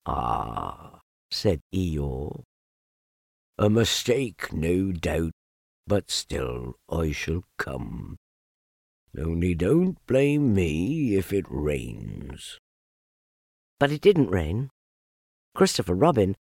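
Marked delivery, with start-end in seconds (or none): 0.0-1.0 low, quiet, glum donkey; gloomy donkey. Acted. 3.6-12.6 gloomy donkey. Acted.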